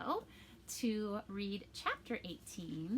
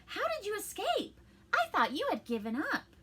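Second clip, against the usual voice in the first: put-on high boy voice; 10 semitones higher, 5 dB louder than usual. Pinocchio voiced in story.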